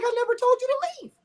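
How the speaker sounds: high, indignant mock retort; playful high-pitched protest. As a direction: throw it back at her, high and indignant. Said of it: "I'm like".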